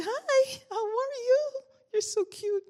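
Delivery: put-on very high, cooing voice for a toddler; baby talk. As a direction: coo up high like greeting a baby. Greeting a toddler in store.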